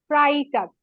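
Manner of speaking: ফ্রাইটাগ as German Freitag, fry-tahg; German Friday. Shown as a demonstration. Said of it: "উচ্চারণ করে দেই".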